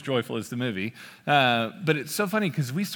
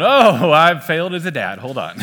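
loud, higher, hammed-up despair; mock-tragic louder cry of defeat; mock dismay; 4 semitones higher, 11 dB louder than usual. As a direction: wail it like a dad who's failed. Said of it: "And I'm going".

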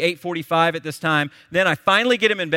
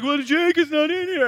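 put-on high, little boy voice; child voice; 12 semitones higher than usual. Dad retells his younger son calling from the dark, small scared child voice.

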